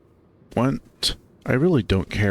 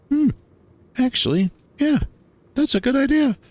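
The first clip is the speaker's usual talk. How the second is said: excited. Acted. She warms to his idea.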